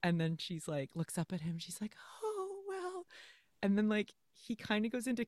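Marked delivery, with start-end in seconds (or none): 2.2-3.1 very high, soft little sigh of words; mock swooning heroine. Heroine looks up at him before a kiss, mock swoon. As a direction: coo it high and small, imitating her.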